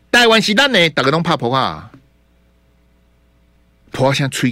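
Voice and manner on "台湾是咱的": slogan lightly imitated, a touch higher; quoting the slogan. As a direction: toss out the rally line with mild pathos. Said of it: "你只要讲说".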